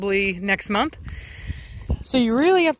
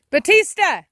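loud call, higher; 9 semitones higher, 8 dB louder than usual. Real, not acted. Owner calling her missing dog, loud call. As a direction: shout his name across the yard.

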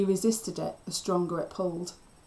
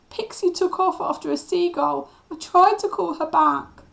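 high, loud tearful explanation; sobbing wail; 10 semitones higher, 8 dB louder than usual. A narrator's reading. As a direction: wail it out, pleading your innocence. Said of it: "Cliff sobbed".

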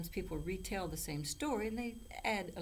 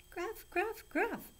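put-on very high-pitched baby goat bleat; bleating little goat; slower than usual. A narrator's reading. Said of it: "bleated the youngest billy goat".

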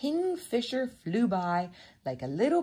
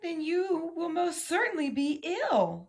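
high-pitched rabbit voice; warning rabbit; 10 semitones higher than usual. A narrator's reading. Rabbit warns greedy Mouse, high and emphatic.

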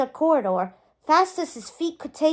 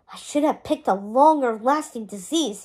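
plain reading voice

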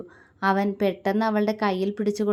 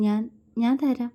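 halting, stuck on the first word; a shy stammer on the opening word; 3 semitones higher than usual. A narrator's reading. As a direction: stumble nervously over the start. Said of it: "അവൾ വിക്കലോടെ പറഞ്ഞു".

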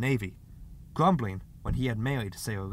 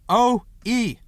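high, loud call through the door; insistent raised voice; 11 semitones higher, 10 dB louder than usual. A narrator's reading. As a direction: call your name to be heard inside. Tries again through shut door.

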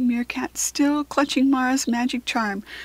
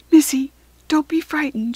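higher, louder reassuring call; 5 semitones higher, 6 dB louder than usual. A narrator's reading. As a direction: call gently but clearly to someone frightened. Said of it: "she called".

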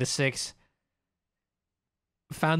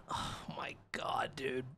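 unvoiced awed whisper; real hushed oh my God; whispered disbelief; 4 semitones lower, 14 dB quieter than usual. Real, not acted. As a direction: breathe it out in shock.

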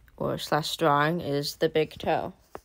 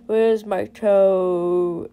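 slow, drawn-out spooky groan, louder; loud, eerie, long-held moan; 6 dB louder, much slower than usual. A narrator's reading. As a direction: stretch it out like a groaning ghost. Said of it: "it groaned".

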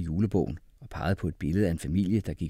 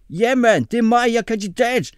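very high, loud character voice; squealed boyish eagerness; eager volunteer; 10 dB louder than usual. A narrator's reading. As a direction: pipe up excitedly at full pitch. Mohammed eagerly volunteers as candidate.

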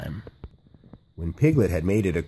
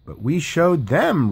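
triumphant shout; 6 semitones higher than usual. A narrator's reading. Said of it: "Roo shouted".

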